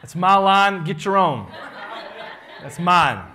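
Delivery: loud teasing mock outrage; playful protest. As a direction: claim your catchphrase back in fun. Teasing Sister Newbie over his phrase.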